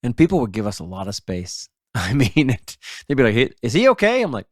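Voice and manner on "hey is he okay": high-pitched concerned question; lightly acted nervous stranger; worried bystander. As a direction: imitate an uneasy passer-by, pitched up. Acting worried passer-by asking about dog.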